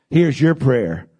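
normal talking voice

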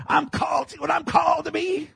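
high-pitched imitation of earnest insistence; high, strained mock proclamation; mock self-important insistence. Mimics himself insisting on his calling.

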